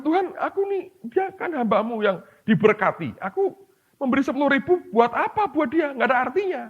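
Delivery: high, pleading voice to God; T D Jackson complaining. Retold or imitated. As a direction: whine to God that the gift means nothing. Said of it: "T D Jackson berkata".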